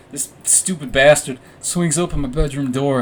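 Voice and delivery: usual speaking voice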